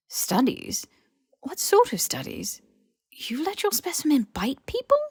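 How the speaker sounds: high, rising appalled questions; shocked outrage. A narrator's reading. Hermione appalled at F's experiments, high shocked questions. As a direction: recoil in disbelief. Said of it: "Hermione gave a start".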